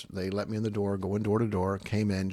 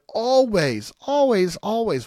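emphatic repetition; 11 semitones higher, 7 dB louder, slower than usual. Real, not acted. Preacher stresses the answer.

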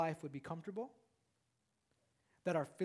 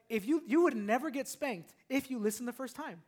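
ordinary talk

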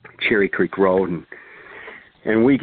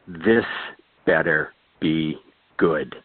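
lower, slow, grumbling boss; gruff boss; 5 semitones lower, slower than usual. Retold or imitated. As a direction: drag it out low like an irritated boss. Annoyed boss comes to phone.

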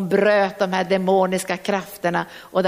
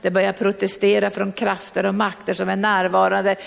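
ordinary talk, nothing marked

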